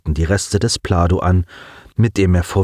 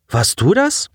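raised pitch, puzzled question; character voice; 9 semitones higher than usual. Acted. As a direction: ask it high and unsure. Character asks, higher pitch.

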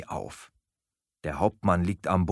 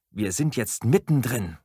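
ordinary delivery, nothing marked

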